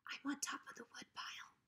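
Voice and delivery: scared little whisper; whispered reply. Acted. Drover whispering back to Hank from hiding. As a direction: breathe it so nobody else hears.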